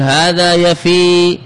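يفي with a very long, slow final ī; final ي kept. Shown as a demonstration. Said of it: "بالإثبات".

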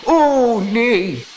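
very high, put-on wail; high-pitched acted horror; dismayed cry. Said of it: "riep hij".

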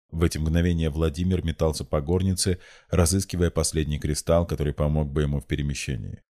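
plain reading voice

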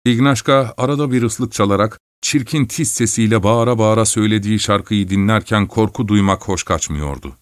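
usual speaking voice

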